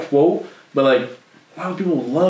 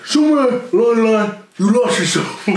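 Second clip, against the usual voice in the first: Stallone voice, jaw pushed out; dumb-lipped Rocky line; underbite; 10 semitones higher, 3 dB louder than usual. Retold or imitated. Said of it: "Sylvester Stallone impersonator".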